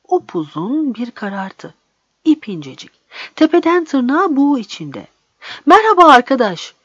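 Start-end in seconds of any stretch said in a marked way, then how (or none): 5.7-6.7 loud, higher-pitched hail; calling out. A narrator's reading. Narrator shouts a greeting after the shadowy figure hurrying off, calling out. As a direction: call out to someone up ahead. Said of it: "diye bağırdım".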